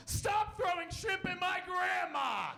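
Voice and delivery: put-on high-pitched, drawn-out kid's yell. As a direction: shout it slowly at the top of a child's voice. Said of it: "I jump up and I yell".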